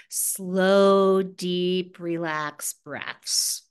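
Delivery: slow, low, softened delivery; slow relaxed guidance. Retold or imitated. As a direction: stretch each word out like a calming meditation guide. Mimics relaxing meditation instructions.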